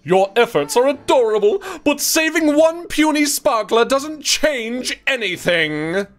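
high, slow mid-Atlantic diva; glamorous old Hollywood star. Acted. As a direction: sneer in mid-Atlantic accent. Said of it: "She would definitely have a mid-Atlantic accent".

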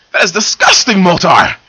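high, loud disgusted shriek. Acted. Silver shrieking at Moltar after he licked her face, loud and high. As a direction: screech it in disgust. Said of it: "she shrieked".

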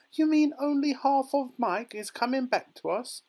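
very high, loud shriek of horror; horrified cry. A narrator's reading. Mother fears for half her son, horrified cry. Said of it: "let out a scream of horror".

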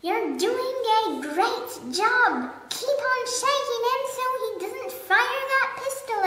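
high, loud encouragement; cried out. A narrator's reading. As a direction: cheer him on urgently. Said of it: "cried the giraffe".

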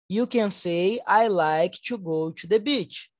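slow, clear teacher answer; slow model answer. Shown as a demonstration. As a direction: model it slowly. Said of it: "teacher models answer". (resposta modelo lenta)

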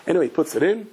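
ordinary talk

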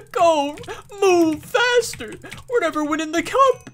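high, drawn-out imitation of a coach near tears; hammed-up tearful coach whine; mock about to cry. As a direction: plead as if about to cry, exaggerated. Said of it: "sounded like he was about to cry".

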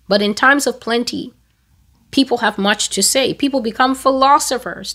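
normal talking voice

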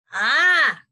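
high, loud "Ah!" of alarm; startled farmer's wife screech. A narrator's reading. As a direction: let out a loud storybook shriek. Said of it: "screeched the farmer's wife".

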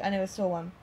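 usual speaking voice

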